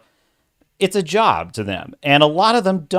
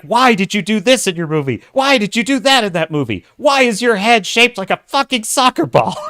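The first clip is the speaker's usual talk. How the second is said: mock rant, rising higher and louder with each question; ranting quote; 8 semitones higher, 4 dB louder than usual. Retelling a critic's rant, building to a higher, louder mock rant. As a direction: rail at the director like a film critic on a tirade. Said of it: "when he's going on a rant".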